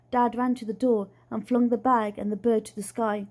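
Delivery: plain reading voice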